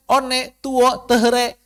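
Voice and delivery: "one, tuo, tehre" read letter by letter; loud, high mock misreading "one, tuo, tehre"; English numbers "one, tuo, tehre" in Indonesian spelling sounds; mangled English. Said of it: "Ibarat orang ngajarin bahasa Inggris".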